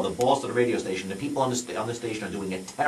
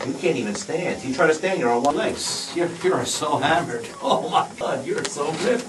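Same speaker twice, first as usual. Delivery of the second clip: hammed-up amused hypnotist; 7 semitones higher, 5 dB louder, slower than usual. Hypnotist describing the drunk man.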